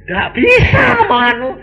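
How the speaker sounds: high, drawn-out cry; mock exasperation. Real, not acted. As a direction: wail it, high and slow. Exclaiming he can no longer.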